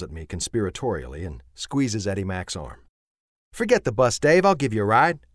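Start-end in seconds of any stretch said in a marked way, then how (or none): 3.5-5.2 higher, louder Southern voice, warm and breezy; Sissy, friendly. A narrator's reading. Sissy offering Dave a ride home, high breezy voice. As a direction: offer the ride like it's nothing.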